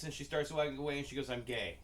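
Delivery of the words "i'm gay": imitating an unmoved cashier; deadpan. Cashier brushes off a compliment while walking away, deadpan. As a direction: say it straight, no reaction at all. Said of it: "her face never fucking changed".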